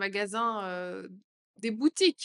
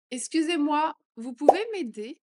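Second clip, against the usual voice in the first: louder and higher plea; 6 semitones higher, 8 dB louder than usual. Shown as a demonstration. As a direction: call for help out loud. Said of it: "phrase modelled twice". (plus fort, plus aigu)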